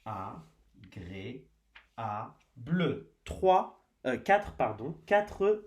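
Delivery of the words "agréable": "Agréable" softly spaced as a, gré, a, ble; split into syllables. Shown as a demonstration. Teacher recounting syllables for pupils, "Agréable" quietly split in four. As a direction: say "Agréable" slow, low and soft, a gap after each syllable. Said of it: "on frappe les syllabes".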